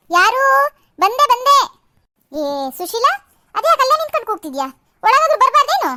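calling back. Acted. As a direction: shout back from inside the house. Answering a call from inside. (ಒಳಗಿನಿಂದ ಜೋರಾಗಿ ಉತ್ತರಿಸುವುದು)

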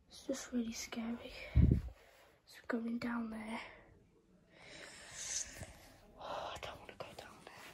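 very soft, fearful whisper; scared whisper. Real, not acted. Player dreading the basement, scared whisper. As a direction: whimper it under your breath.

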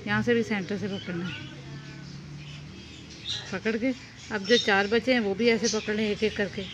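ordinary talk, nothing marked

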